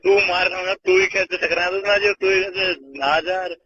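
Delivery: ordinary delivery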